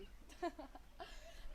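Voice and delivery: light derisive cackle; cackling. A narrator's reading. Animals all cackling at the sheepdog, mocking. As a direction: cackle along with the pack. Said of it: "The animals all began cackling".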